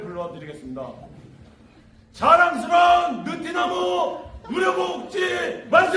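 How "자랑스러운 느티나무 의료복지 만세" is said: slow, high, drawn-out rallying cry; cheer leading shout. Real, not acted. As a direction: lead the crowd in a cheer. 만세 삼창을 선창함. (만세 선창 외침)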